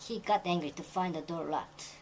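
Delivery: very slow, careful read of the short form; slow shortened version. Shown as a demonstration. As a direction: read the short form slowly to set it against the long one. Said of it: "그걸 줄이기 위해서". (천천히 읽는 줄인 문장)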